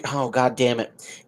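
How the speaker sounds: ordinary talk, nothing marked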